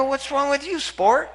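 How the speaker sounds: high, playful needling; teasing. Retold or imitated. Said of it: "And I go".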